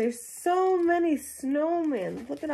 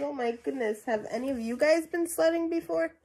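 usual speaking voice

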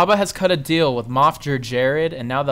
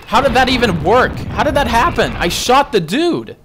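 baffled disbelief; 7 semitones higher, 4 dB louder than usual. Real, not acted. Baffled by dying in game.

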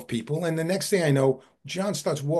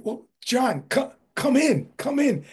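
eager welcome; 9 semitones higher, slower than usual. Retold or imitated. Owners welcoming famous historian.

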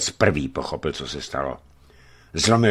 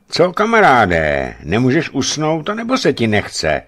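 loud, raised call to a stranger; booming giant; 7 semitones higher, 6 dB louder than usual. A narrator's reading. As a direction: boom it out heartily, pitched up. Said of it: "zahučel velikán". (zvučné, hlasité zahučení)

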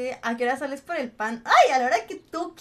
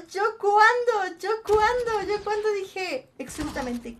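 dramatic slow protest; 6 semitones higher, 4 dB louder, slower than usual. Real, not acted.

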